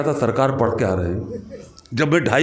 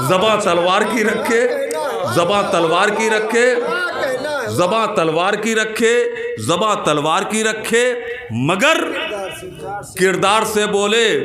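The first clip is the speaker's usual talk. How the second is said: high, projected, insistent repetition; Josheela शेर-पाठ; 5 semitones higher, slower than usual. Acted. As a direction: hammer the line out high, pausing after each repeat. वही शेर जोश से. (ऊँचे सुर में शेर दोहराना)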